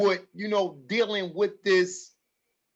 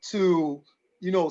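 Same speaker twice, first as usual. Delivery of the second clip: ordinary talk, nothing marked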